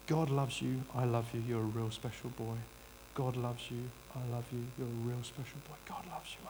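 soft, low, loving murmur imitating a grandfather; tender grandfather. Grandfather reassuring the boy in his arms, tenderly. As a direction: repeat it softly, fading almost to a whisper.

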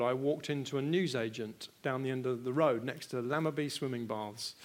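ordinary delivery

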